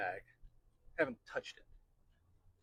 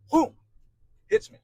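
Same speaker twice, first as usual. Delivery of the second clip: sudden whoosh effect; 16 dB louder than usual. Retold or imitated. Sudden realization, sound effect.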